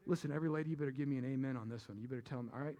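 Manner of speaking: usual speaking voice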